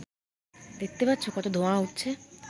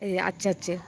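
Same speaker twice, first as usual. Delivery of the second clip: usual speaking voice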